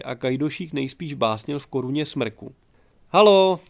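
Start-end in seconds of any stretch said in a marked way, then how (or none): 3.1-3.6 much louder, higher second call; calling again. A narrator's reading. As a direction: halo shouted harder, more urgent. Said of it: "zavolala podruhé". (ještě hlasitější volání)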